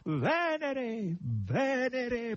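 high, swooping triumphant call; exultant, drawn-out cry; victorious proclamation. A narrator's reading. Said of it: "proclamò vittoriosamente Natascia".

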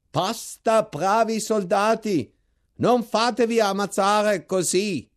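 high, slow, loud plea; pleading shout. A narrator's reading. Chaplain calling to the attacking soldiers, a slow high pleading shout. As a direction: beg the soldiers to stop, calling out. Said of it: "mi parve un cappellano".

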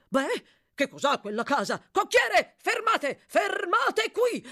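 agitated order building to a high, loud shout. A narrator's reading. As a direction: yell urgently up to the coachman. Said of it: "gridò il dottore". (grida concitate)

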